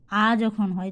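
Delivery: usual speaking voice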